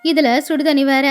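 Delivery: ordinary delivery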